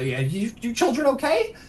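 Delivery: lightly voiced gnome checking on children; Ampharius. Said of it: "he looks over and he goes".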